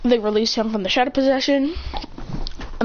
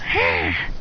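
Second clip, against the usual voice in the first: short, high imitated yelp; mock pain cry; 13 semitones higher than usual. Character bites his hand, cries out.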